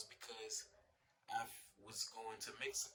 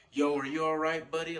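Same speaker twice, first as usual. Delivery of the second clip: louder, higher concerned bystander; raised-voice check on a stranger; bystander calling out; 5 semitones higher, 10 dB louder than usual. Retold or imitated. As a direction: call out to check on someone. Bystander checks on crash victim.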